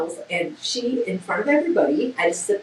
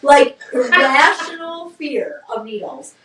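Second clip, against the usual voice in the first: slow, emphatic words broken by a laugh; laughing admission; 3 semitones higher, slower than usual. Real, not acted. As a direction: laugh at your own silly fear. Laughing at own needle fear.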